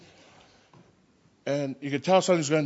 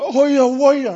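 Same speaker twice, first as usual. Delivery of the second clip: high, loud retold cry of the mum; sudden high-pitched yell, imitating the mum; mum shouting; 11 semitones higher, 11 dB louder, much slower than usual. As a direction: belt it out high like an alarmed mother. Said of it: "the mum saw me and she went".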